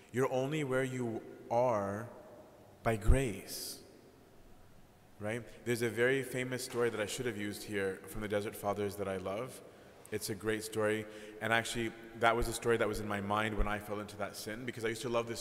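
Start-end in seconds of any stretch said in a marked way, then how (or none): none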